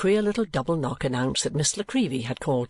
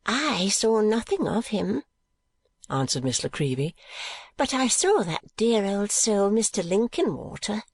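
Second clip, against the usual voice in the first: chatty Miss La Creevy; 7 semitones higher than usual. A narrator's reading. Visitor answering about who she saw, fond. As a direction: report it high and fondly.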